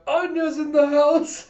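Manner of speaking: put-on high, loud, drawn-out kid's announcement; big hammed-up arrival cry; Anya. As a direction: shout it slowly in a high character voice. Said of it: "and you have".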